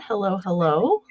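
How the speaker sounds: ordinary delivery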